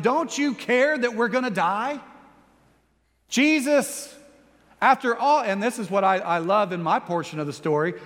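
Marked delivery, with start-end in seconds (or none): 0.0-5.4 frantic shout. Acted. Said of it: "they shout to him".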